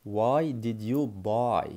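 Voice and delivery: very slow; slow teaching. Shown as a demonstration. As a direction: spell it out for learners. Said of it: "لماذا اشتريت؟".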